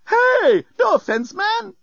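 very high, protesting hipster robot; hurt robot. A narrator's reading. Bellbot to cross passenger, hurt and protesting. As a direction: protest your innocence in a high voice. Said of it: "seemed genuinely hurt".

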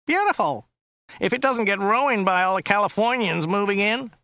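high, nasal driver voice; Joe's nasal whine. A narrator's reading. Driver making small talk with his passenger, high and nasal. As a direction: whine it high through the nose. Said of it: "His voice was rather high and whiny".

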